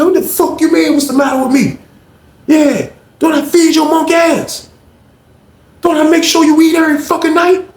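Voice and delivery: high, indignant rant; acted angry retort. Lamont argues back in quarrel.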